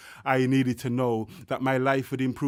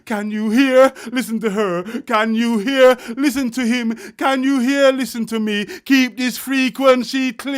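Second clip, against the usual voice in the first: loud, higher urgent appeal; urgent call; 7 semitones higher, 8 dB louder than usual. Acted. Poet urging his audience to tune in, loud and urgent. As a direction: rouse the audience to listen.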